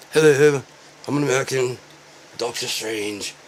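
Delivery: a hint of a posh British actor; Benedict Cumberbatch. Mocking a British actor playing an American, mild British accent. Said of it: "Benedict Cumberbatch".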